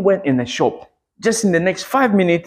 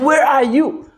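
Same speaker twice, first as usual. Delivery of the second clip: high, raised plea to God; calling out in prayer; 9 semitones higher than usual. Retold or imitated. Man praying to a God he looks for, calling out. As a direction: cry it out as if searching. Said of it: "I said".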